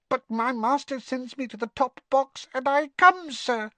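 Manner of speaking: very high squeaking voice; a house elf meeting someone famous; tiny elf squeak. Acted. Winky introducing herself to Harry, awed squeak. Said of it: "a teeny quivering squeak of a voice".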